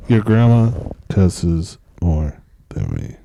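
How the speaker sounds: slow, low, loud podcast-host delivery; mock broadcaster, very slow and deep. Shown as a demonstration. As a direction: draw it out in a big, low host voice. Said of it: "What if this was my podcast voice?".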